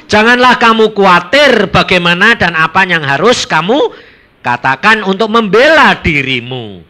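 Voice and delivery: loud, ringing Bible reading from the pulpit; preacher reading the text out loud; scripture reading. Acted. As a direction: proclaim the verse to the whole hall. Reads scripture aloud to congregation.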